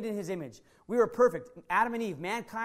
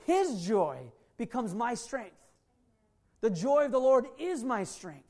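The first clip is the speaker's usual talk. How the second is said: ordinary delivery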